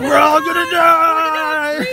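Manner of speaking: high, slow, dragged-out doom yell; mock panic shout. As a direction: wail it in hammed-up terror.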